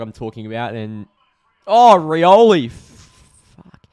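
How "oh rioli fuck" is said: loud, high, drawn-out cry; real fan's outburst, stretched and loud; anguished exclamation. Real, not acted. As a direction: cry out slowly at a chance going begging. Exclaims at play mid-chat.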